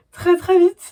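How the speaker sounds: high, loud and eager; can't wait, pushing the words up; bursting with impatience for book three; excited. Real, not acted. Eager for the next volume.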